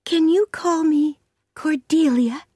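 put-on high little girl voice; high-pitched, hopeful child's request; hopeful orphan girl. A narrator's reading. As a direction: ask pleadingly as a small child.

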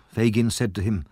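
ordinary delivery, nothing marked

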